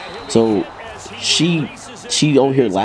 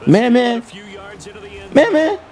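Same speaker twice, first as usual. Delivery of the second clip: calling a name; 12 semitones higher than usual. Retold or imitated. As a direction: call his name out high. Gang calling his name.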